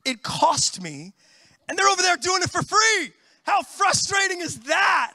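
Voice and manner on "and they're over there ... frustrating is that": mock exasperated shout. Real, not acted. Pastor complaining about drivers in the free lane, exasperated shout. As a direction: vent it, half joking.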